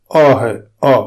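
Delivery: long open aa; sound aa. Shown as a demonstration. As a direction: stretch the vowel aa in آ. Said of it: "اس کی آواز اور تلفظ".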